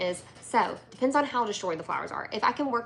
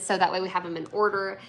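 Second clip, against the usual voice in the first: normal talking voice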